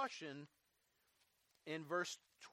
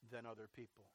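ordinary talk, nothing marked

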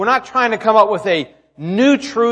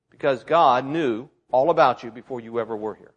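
usual speaking voice

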